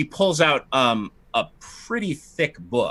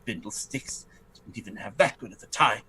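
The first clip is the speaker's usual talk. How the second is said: much softer, lower muttered aside; 5 semitones lower, 7 dB quieter than usual. Acted. Lady grumbling to herself in the bedroom, soft. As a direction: grumble it under your breath. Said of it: "she says".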